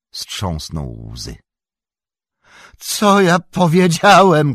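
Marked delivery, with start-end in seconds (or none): 2.8-4.5 high, slow, loud anguished cry; krzyk. A narrator's reading. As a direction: cry out in horror at yourself. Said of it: "krzyknął". (przeciągły, rozpaczliwy krzyk)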